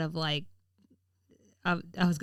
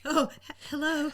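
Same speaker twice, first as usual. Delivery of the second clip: halting, higher nervous greeting; 7 semitones higher than usual. Real, not acted. Told to just say hi on the podcast, nervous. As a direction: stumble nervously into a hello. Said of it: "sound like you're so nervous".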